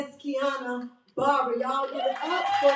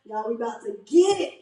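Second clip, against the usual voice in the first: usual speaking voice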